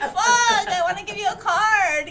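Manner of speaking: exasperated outburst. Retold or imitated. Blurting out at a pale chest.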